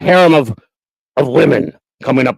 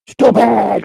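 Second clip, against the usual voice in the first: a hint of the dopey voice, shouted higher; failed impression attempt; 7 semitones higher than usual. Host trying Lou's impression, half-hearted mock yell. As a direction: try the puppet yell and fall short. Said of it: "Ah, I can't even do it".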